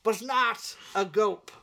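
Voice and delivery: pompous mock boss. Acted haughty boss ranting.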